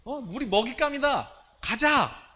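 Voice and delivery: high-pitched, fervent rallying speech; impassioned faith declaration. Acted. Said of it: "이렇게 두 사람은 믿음으로 선포하고".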